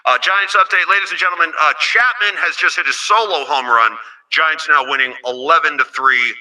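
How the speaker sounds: loud school-principal announcement. Acted. Host interrupts with a Giants score update as the school principal, principal voice. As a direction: project it like a principal over the PA. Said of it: "let me get the, uh, principal voice".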